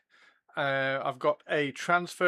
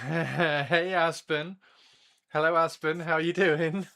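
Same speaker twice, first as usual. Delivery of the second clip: usual speaking voice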